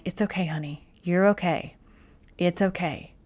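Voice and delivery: hushed and tender, soothing a scared dog; soft soothing voice; speaking softly. Retold or imitated. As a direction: gently murmur comfort to a frightened pet. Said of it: "speak softly to her".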